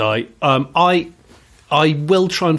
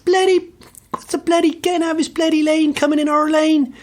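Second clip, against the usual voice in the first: much higher, exasperated imitation of the instructor; ranting instructor. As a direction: rant at the weaving driver, half outraged. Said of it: "he'd go".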